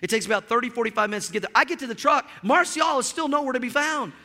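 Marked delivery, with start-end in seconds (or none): none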